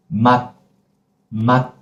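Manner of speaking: 맛 said short, stopped on an unreleased t; closed, cut final. Shown as a demonstration. Teacher drills learners, 맛 closed and cut. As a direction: cut 맛 off with no release. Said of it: "we have to close and cut".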